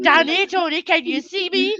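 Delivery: slurring it out in broad Scottish; Scottish accent. Retold or imitated. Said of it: "I could not break my Scottish accent".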